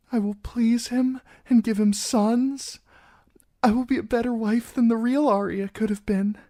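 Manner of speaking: put-on high, girlish and anxious; a frightened young bride trying to sound brave; nervous young bride pleading her case.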